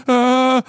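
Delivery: high loud panicked wail; panic cry. Retold or imitated. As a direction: yelp like a panicking child. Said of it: "like, panic sound".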